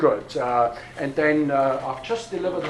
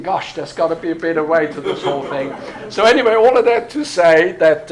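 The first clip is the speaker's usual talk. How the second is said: laughing; 5 dB louder than usual. Real, not acted. Laughing at his own past confusion.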